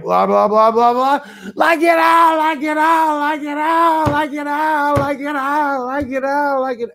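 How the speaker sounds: imitating a hysterical, unstoppable verbal loop; Jim's manic laughing fit. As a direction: shout it like a man wound up into hysterics. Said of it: "where he was laughing so hard".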